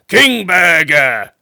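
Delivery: slow, loud gruff wizard address; rough wizard. A narrator's reading. Wizard confronting the king, roughly. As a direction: growl the king's name, slow and heavy. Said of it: "he said roughly".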